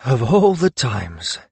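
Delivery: slow, exasperated grumble; cursing. A narrator's reading. Karun summoned at a bad moment, cursing slowly. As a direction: drag out the mild curse. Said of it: "Karun cursed".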